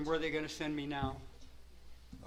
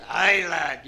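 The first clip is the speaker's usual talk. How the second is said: loud Scottish pirate "Aye, lad"; a hammed-up Scottish pirate call; 15 dB louder than usual. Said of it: "who's the Scottish pirate".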